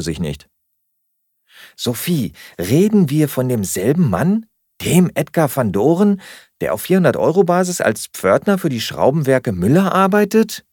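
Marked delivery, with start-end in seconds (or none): 1.8-10.6 high, swooping sceptical question; incredulous challenge. A narrator's reading. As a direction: question her as if she were mad. Flatmate challenges her about Edgar. (ungläubig und spöttisch nachgehakt)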